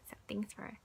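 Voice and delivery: soft whispered thanks, no voice. Real, not acted. Whispering thanks to Betty nearby, hushed. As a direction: breathe the words out as a hushed aside.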